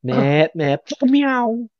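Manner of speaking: high, mocking meow; Irish boy meowing. A friend imitating a viral cartoon clip, mock meowing. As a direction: yowl it out like the cartoon cat. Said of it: "of him being like some Irish boy".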